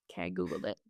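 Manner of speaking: a breathy hush, half ashamed; whispered confession. Real, not acted. Admitting to a friend she Googled it, in a whisper. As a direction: let it slip out under your breath.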